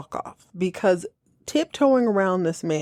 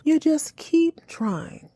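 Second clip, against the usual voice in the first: slow, sugary teacher encouragement; slow, syrupy pat on the head; mock patronising teacher; 8 semitones higher, much slower than usual. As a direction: coo it like a patronising third grade teacher. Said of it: "talking to him like my third grade teacher".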